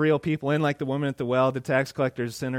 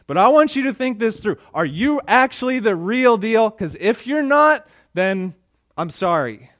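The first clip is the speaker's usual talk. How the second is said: challenging Jesus; 6 semitones higher, 8 dB louder than usual. Retold or imitated.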